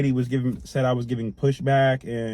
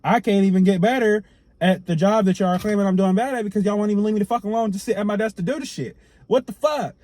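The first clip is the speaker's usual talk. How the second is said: irritated outburst; 7 semitones higher than usual. Retold or imitated. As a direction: let the frustration spill over, rising to the end. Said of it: "I'm just irritated at this one".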